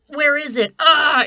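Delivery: a hammed-up yelp of alarm; mock panic. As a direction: act out the sudden panic of a missing pen. Panicked search for lost pen.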